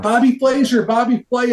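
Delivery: high-pitched, starstruck crowd imitation; excited crowd. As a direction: call it out like thrilled onlookers spotting a celebrity. Voices crowd spotting a celebrity.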